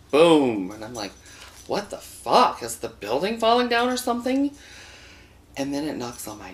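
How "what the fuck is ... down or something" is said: startled. Retold or imitated. Startled by pounding at door.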